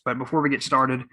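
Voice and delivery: normal talking voice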